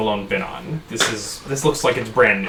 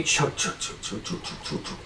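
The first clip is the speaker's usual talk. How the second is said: whispered chug chug of a steam train; breathy rhythmic train noise, fading; train chugging sound; 12 dB quieter than usual. Pretend. GM imitating the train for the players, whispered chugging. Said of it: "Really good train sound".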